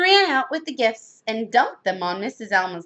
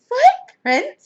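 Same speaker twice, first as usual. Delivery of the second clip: high, loud exclamation of dismay; shocked outburst; 14 semitones higher, 8 dB louder than usual. A narrator's reading. Missus Rogers finds the footprints, shocked. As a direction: cry out at an appalling find.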